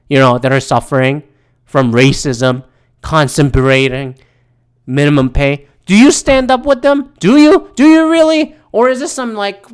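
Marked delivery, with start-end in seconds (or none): 5.9-9.7 high, loud, pressing questions; indignant challenge. Real, not acted. Ranter challenging protesters on solidarity, indignant and insistent. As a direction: press them hard, pitch and volume raised.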